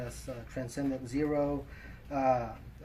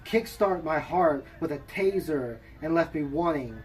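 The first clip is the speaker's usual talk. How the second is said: poem recitation; 3 semitones higher, 5 dB louder than usual. Acted. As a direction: recite it with measured pauses between phrases. Reads own poem aloud.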